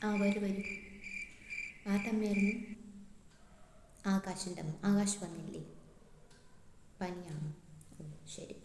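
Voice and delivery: acted subdued class teacher; teacher who says whatever. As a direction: speak quietly to a parent, barely projecting. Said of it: "That എന്തൊക്കെയോ പറയുന്ന teacher".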